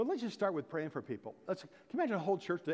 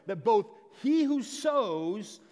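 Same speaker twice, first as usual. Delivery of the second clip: louder, raised sermon delivery; fervent preaching; 4 semitones higher, 6 dB louder than usual. Acted. Pastor urging his church to pray, fervent preaching. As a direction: drive the points home to the pews.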